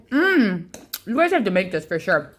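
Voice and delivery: talking with mouth full. Real, not acted. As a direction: speak around a bite of food. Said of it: "I'm talking with my mouth full".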